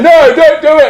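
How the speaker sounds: high-pitched mock plea; acted high protest; protesting crowd. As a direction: cry out like an alarmed audience. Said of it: "people are going".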